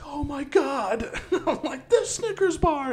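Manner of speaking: high, slow, swooning over a candy bar; mock ecstatic. Kid in youth group skit, hammed-up ecstatic swoon. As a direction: gush like it's the best thing ever. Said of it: "and I'm like".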